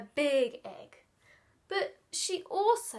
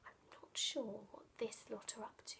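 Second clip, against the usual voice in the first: whispered aside; 4 semitones lower, 14 dB quieter, faster than usual. Real, not acted. Aside to listeners about pictures.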